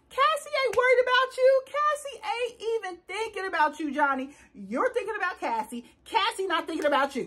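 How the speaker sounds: sassy comeback. Retold or imitated. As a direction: talk down to her with attitude. Her own retorted speech, acted.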